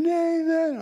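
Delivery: very high, drawn-out call; imitating a faraway shout; distant calling voice. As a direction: call a name across the hills. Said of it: "and I yell again".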